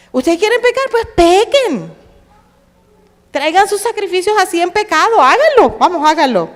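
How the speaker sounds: high, loud mocking challenge; sarcastic dare. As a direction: dare them, dripping with irony. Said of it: "Eso es hablar con sarcasmo". (reto sarcástico, agudo y fuerte)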